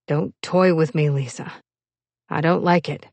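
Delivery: low-pitched, understated man's warning; mild stern warning. Acted. Rebuffed lover telling Lisa not to toy, mildly stern. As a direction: warn her off with a touch of sternness.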